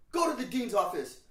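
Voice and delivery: loud, high-pitched teacher command; mock stern order. Teacher ordering a student to the dean's office, loud and stern.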